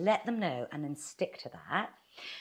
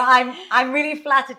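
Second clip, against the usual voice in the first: over-the-top gushing, much louder and higher; sarcastic; 7 semitones higher, 15 dB louder than usual. Shown as a demonstration. Coach demonstrating a polite refusal, overdoing it sarcastically. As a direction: overdo it till it sounds insincere. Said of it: "that's a bit sarcastic".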